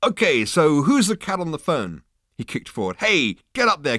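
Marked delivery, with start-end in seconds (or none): 0.0-2.0 loud, high, slow demand; brash hipster. Acted. Zaphod asks waiter who is calling, brashly. 3.0-4.0 loud, high, slow rousing call. Acted. Zaphod kicks Ford under the table, rousing him loudly.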